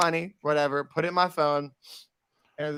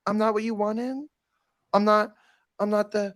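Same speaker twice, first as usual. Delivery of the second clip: higher, sugary fake pity; mock sweet concern; 5 semitones higher than usual. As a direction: tease him with fake sweetness. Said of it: "I was like".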